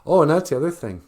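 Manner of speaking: ordinary talk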